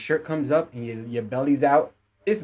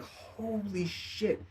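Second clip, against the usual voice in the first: hushed shock; 7 semitones higher, 11 dB quieter, much slower than usual. Retold or imitated. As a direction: gasp it out slowly under your breath. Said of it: "I'm like".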